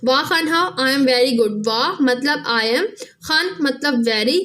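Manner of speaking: slow, deliberate word-by-word glossing; walk the students through each word slowly; patient teacher breaking a sentence into words; slow teaching. Shown as a demonstration. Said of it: "फिर इसी तरह से".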